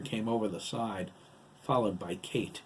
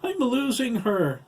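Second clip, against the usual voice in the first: high, loud desperate cry; pleading, high-pitched shout; desperate sobbing plea; 14 semitones higher, 9 dB louder, slower than usual. A narrator's reading. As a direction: beg for help as your grip fails. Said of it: "He sobbed".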